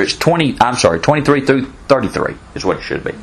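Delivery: rapid-fire auctioneer patter; fast like an auctioneer. Real, not acted. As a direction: rattle it off like an auctioneer. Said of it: "I'm talking like an auctioneer here".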